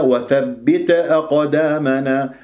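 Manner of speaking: وثبت with an echoed vowel after ت; bounced ta, wrong. Shown as a demonstration. Teacher showing the qalqala mistake, echoed ta. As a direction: bounce the ت before أقدامنا. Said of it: "قلقلة".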